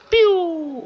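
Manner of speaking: a high, quick "piu" like a toy laser; mouth gun noise. Acted. Gamer voicing his own jump for viewers, playful high "piu". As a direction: squeak out a cartoon shot sound. Jumping in game, mouth sound.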